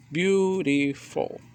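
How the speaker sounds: "Beautiful" slowly, beau-ti-ful; each syllable of "Beautiful" a separate beat; syllable demonstration. Shown as a demonstration. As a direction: sound out "Beautiful" piece by piece. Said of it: "two or more syllables".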